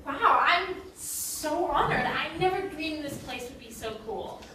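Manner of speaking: drawn-out, slow gushing; mock awe. Captive flattering the hostess, mock awe. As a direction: flatter with a show of wonder.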